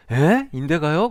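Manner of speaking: high, loud startled "what, my ligament?"; a jolt of alarm at the diagnosis; shocked patient. Retold or imitated. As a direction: blurt it out in disbelief. Patient shocked at doctor's news.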